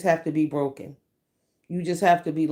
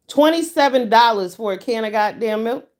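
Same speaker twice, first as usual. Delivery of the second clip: louder, higher outraged shout; ranting about a can of formula, loud and outraged; 4 semitones higher, 6 dB louder than usual. Real, not acted. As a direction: blast the price in disbelief. Outraged at baby formula price.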